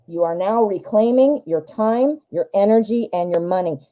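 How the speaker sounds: ordinary talk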